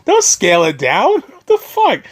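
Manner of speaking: high, incredulous whining protest; exasperated disbelief. Retold or imitated. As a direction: plead with the filmmakers in disbelief. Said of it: "I'm like".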